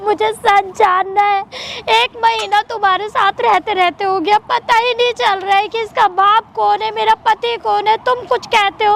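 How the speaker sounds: sobbing, pleading for answers; tearful, distraught outpouring; crying. Real, not acted. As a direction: plead through tears. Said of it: "रो मत".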